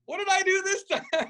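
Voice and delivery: high, laughing mock protest; hammed-up high exasperated question; laughing exasperation. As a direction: plead innocence through a laugh. Acted exasperated reply, laughing.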